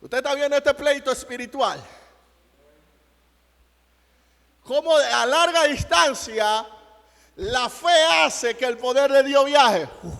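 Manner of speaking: loud, high-pitched sermon climax; loud, fervent preacher's proclamation; full-force preaching. Real, not acted. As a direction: preach it out to the whole congregation at full power.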